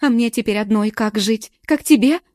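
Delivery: loud, high, bitter screaming; tearful shrieking. A narrator's reading. Galka shrieking accusations at her friend, tearful. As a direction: spit out the hurt as she screams. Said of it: "Галка уже визжала".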